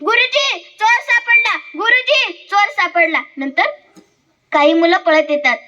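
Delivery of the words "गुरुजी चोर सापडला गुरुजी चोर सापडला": high-pitched schoolboy alarm; acted cry of discovery; calling out. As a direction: call the teacher urgently, pitched high. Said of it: "तो जोरात ओरडतो".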